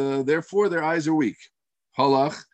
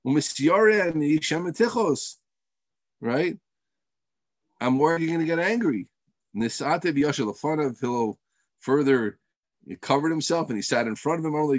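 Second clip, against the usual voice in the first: ordinary talk, nothing marked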